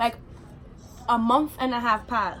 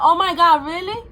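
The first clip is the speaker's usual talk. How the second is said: louder, higher, amused oh my God; amused surprise; 7 semitones higher, 9 dB louder than usual. Retold or imitated. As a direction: replay his delighted, laughing surprise. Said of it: "He started cracking up".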